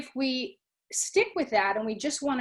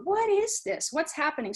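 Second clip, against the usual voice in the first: lightly voiced wide-eyed kid, higher; curious little child; 7 semitones higher than usual. Teacher playing a curious child, mildly raised pitch. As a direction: ask it like a small child discovering something. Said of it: "Sort of like little children".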